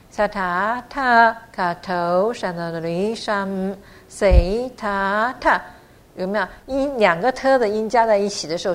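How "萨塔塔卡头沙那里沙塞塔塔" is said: long, stretched syllables at half speed; slow model recitation. Shown as a demonstration. Teacher's repeat recitation for learners, very slow. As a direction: chant it slowly, holding the long sounds. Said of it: "再听两遍".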